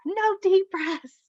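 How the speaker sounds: high, slow mock scream of a defiant child; retold scream. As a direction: shout it high and drawn out like a stubborn kid refusing. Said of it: "she screams back".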